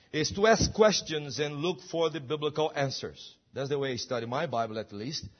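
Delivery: ordinary talk, nothing marked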